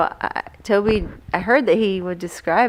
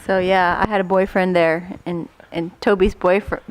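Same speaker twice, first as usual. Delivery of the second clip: ordinary talk, nothing marked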